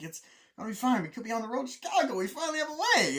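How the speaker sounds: high-pitched. Retold or imitated. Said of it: "Steve Martin's voice is all high-pitched".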